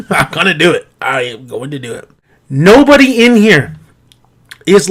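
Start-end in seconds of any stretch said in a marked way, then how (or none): 0.1-0.9 lower-pitched, chuckled resolve; amused. Real, not acted. Amused resolve after own sound effect. 1.0-2.1 low, very soft, every word spelled out; quiet resolve. Real, not acted. Repeats resolve low and deliberate.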